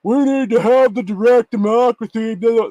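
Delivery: loud, high-pitched mock moaning; exaggerated bellyaching in a put-on voice; mocking whine. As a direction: mimic a griping politician with open contempt. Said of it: "was bitching and moaning about stuff".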